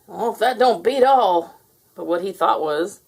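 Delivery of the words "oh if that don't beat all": louder folksy deputy exclamation; blabbering deputy. A narrator's reading. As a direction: blurt it out like a country lawman. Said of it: "Deputy Bob blabbered".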